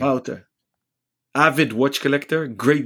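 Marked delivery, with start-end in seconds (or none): 0.0-0.5 Wouter said VOW-ter with a falling tune; correcting pronunciation. Shown as a demonstration. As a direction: model the name Wouter once more, VOW-ter. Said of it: "we need to work on your".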